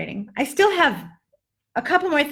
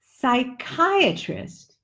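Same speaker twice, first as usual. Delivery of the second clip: "psychiatrist," drawn out slowly, each syllable clear; teacher slowly modelling "psychiatrist,"; stretched "psychiatrist,"; slow demo; much slower than usual. Shown as a demonstration. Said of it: "we have psychiatrist".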